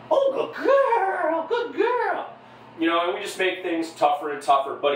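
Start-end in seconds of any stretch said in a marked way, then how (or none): 0.1-2.3 high, slow cheerleader praise; schoolgirl voice. Real, not acted. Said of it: "schoolgirl voice".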